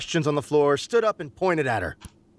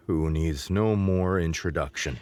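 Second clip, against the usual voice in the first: shaky nervous host voice; 9 semitones lower than usual. A narrator's reading. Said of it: "continued with a shaky voice".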